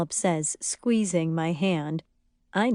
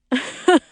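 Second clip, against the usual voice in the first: high, loud cackling laugh; short burst of helpless laughter; uncontrollable laughter; 7 semitones higher, 5 dB louder than usual. A narrator's reading. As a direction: keep cracking up. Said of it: "Danny can't stop laughing".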